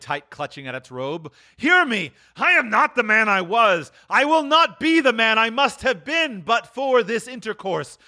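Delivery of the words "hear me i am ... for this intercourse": high, urgent pleading; desperate cry. Acted. Scrooge pleading with the spirit, high desperate cry. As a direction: plead at full pitch for a hearing. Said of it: "he cried".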